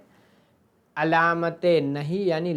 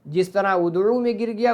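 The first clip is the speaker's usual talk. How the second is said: ordinary talk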